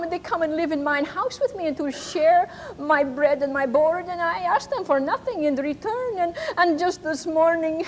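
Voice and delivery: put-on Ma in a German accent telling how her children threw her out. Ma pours out her story to the church, German accent. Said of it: "kind of a German accent".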